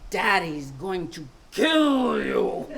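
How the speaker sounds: slow, loud dramatic threat. Retold or imitated. Little sister warns from the stairs, dramatic and slow. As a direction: draw it out for drama. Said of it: "She was very dramatic".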